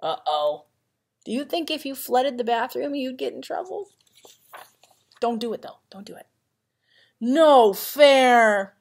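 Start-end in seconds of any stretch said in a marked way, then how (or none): none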